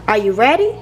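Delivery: ordinary delivery, nothing marked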